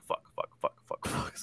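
whispered panicked curses under the breath; inner panic. Retold or imitated. Retelling the ghost story, whispering his silent panic. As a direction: breathe the curses out without voice, scared. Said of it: "in my head, I was like".

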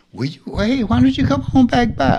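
high, sing-song coaxing invitation; a sly high-pitched beckoning; mock coaxing caller. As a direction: lure them back in a sweet high voice.

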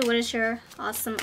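usual speaking voice

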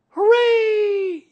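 very high, drawn-out cheer; child's shout. A narrator's reading. Boy cheering the bear's flight, high shout. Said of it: "you shouted".

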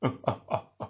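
soft, airless mocking chuckle; a hidden villain laughing at them; breathy villain laugh. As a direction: laugh from everywhere and nowhere. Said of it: "laughter filled the chamber".

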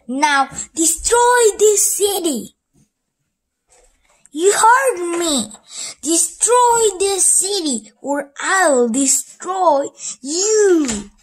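high, loud furious villain shout; villain yelling at his robot to destroy; angry threat. Acted.

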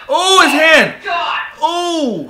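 sudden high yelp of shock; startled yell. Real, not acted. Wincing at a fail clip, shocked yell. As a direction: cry out as the hand hits.

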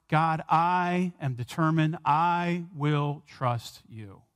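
very slow, deliberate promise; slow resolute vow. Acted. David vowing to God whatever friends do, slow and resolute. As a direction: lay each word down firmly.

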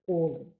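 «олень» stressed on the first syllable, Олень; «олень» with the stress on the opening О. Shown as a demonstration. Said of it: "наголос падає саме на перший склад".

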